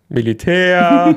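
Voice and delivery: sehr gedehnt, höher und lauter; langgezogenes, lautes Geständnis als Pointe; genüssliche Pointe. Real, not acted. Zögert, dann gedehnte Pointe. (genüsslich langgezogen)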